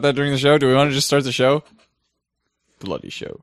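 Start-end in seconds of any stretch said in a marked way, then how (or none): 2.8-3.4 low, soft ominous voice; spooky voice. Pretend. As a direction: intone it like a horror host. Spooky show intro.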